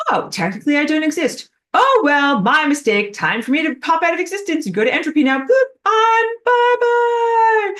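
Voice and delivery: high, quick cartoonish voice, rising to the goodbye; cheery mock farewell. Non-existent thing bowing out to entropy, comically cheerful. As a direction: pop off happily in a silly high voice.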